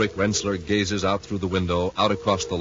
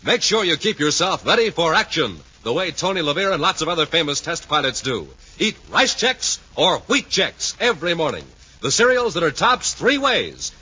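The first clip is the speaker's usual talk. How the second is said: higher, louder punchy commercial pitch; booming radio ad slogan; hard-sell ad read; 9 semitones higher, 4 dB louder than usual. Acted. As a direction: sell the cereal with big energy.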